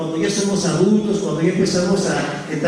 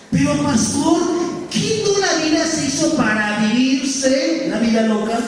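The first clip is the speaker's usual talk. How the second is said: put-on higher, pleading teen protest; cheeky youth; 6 semitones higher than usual. Youth objecting to the pastor, mock whiny and high. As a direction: whine it like a teen talking back.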